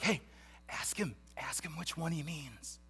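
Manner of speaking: hushed, voiceless whisper; discreet whisper. Acted. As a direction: whisper it across the table so others won't hear. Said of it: "he's trying to be discreet".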